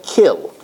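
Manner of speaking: "kill" for kiln, no N at the end; alternate silent-N form. Shown as a demonstration. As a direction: end "kill" on the L, leaving off kiln's N. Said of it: "an alternate pronunciation of kill".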